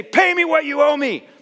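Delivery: loud, high acted demand; angry demand. Preacher voices the temptation to demand repayment, angrily. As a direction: demand it like an outraged creditor.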